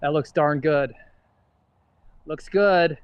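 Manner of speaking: loud, pleased reaction to a good shot; excited. Real, not acted. Golfer reacting to his shot.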